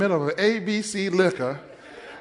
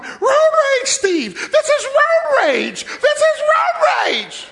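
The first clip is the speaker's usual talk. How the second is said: high, loud, slow shriek of road rage; wife shrieking at the pastor, high and loud; screaming wife; 6 dB louder, slower than usual. Retold or imitated. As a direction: scream it at him from the car. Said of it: "All she going".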